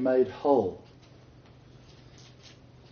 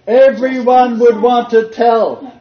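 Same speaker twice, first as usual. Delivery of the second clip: much higher, louder, slow emphatic yes; excited approval; 8 semitones higher, 9 dB louder, slower than usual. Real, not acted. Teacher thrilled at a student's answer, excited. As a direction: reward the student who got it.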